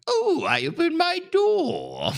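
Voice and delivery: high, slow put-on character voice; drawn-out squeaky role voice on cue. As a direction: stretch it out in a high silly voice. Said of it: "Pest, this is your bit".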